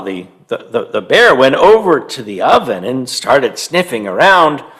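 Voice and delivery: usual speaking voice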